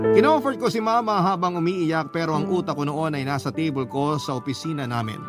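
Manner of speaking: ordinary delivery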